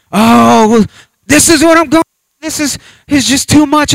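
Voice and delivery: put-on high, loud childish whine; whiny tantrum. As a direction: whine like a little child throwing a fit. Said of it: "throw a tantrum like a little child".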